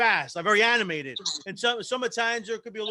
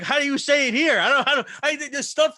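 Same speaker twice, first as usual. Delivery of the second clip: higher, louder mock exasperation; raised voice, half-amused frustration; exasperated retelling; 5 semitones higher, 5 dB louder than usual. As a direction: replay your baffled plea to be understood. Retold failure to be understood.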